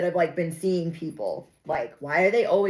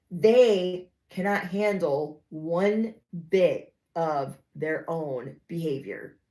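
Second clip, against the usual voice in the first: normal talking voice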